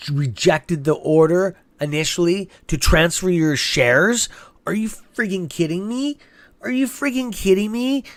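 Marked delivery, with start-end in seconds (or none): 4.6-6.2 rising, disbelieving question; incredulous outrage. Real, not acted. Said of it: "that pisses me off". 6.6-8.0 much higher, pressed repeat of the question; real angry disbelief. Real, not acted. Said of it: "that pisses me off".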